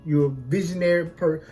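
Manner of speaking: usual speaking voice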